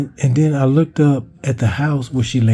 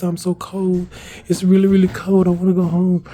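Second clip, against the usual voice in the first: imitated plaintive plea; 7 semitones higher than usual. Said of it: "laying on the ground crying".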